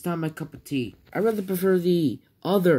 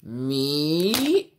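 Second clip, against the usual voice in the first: usual speaking voice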